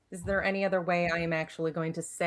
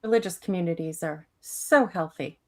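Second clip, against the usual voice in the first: ordinary talk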